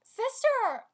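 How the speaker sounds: high, loud cry to a sister; calling out. Acted. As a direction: call out sharply to your sister. Character calls out to sister.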